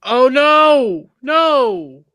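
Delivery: loud high shocked outburst; shocked cry. Real, not acted. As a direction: cry out in alarm. Shocked at the deleted post.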